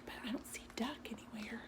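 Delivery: quick, very soft whisper; whispered search. Real, not acted. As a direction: hush it quickly, looking around.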